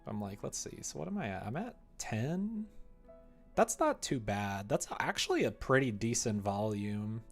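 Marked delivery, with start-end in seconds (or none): none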